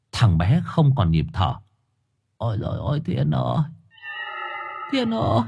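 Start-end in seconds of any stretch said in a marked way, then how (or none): 2.4-5.4 soft gasped lament climbing high; stricken, breaking into a high wail; grief-stricken cry. A narrator's reading. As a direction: call out to the dead boy in shock. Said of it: "mà khóc nức nở".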